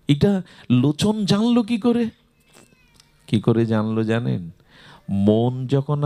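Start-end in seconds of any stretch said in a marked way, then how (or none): 0.1-2.1 high, amazed questioning; retold astonishment. Mahaprabhu wonders how absent Lochan found out, acted as astonished. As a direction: lift the pitch in acted bewilderment. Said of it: "অবাক হয়ে মহাপ্রভু বলছেন".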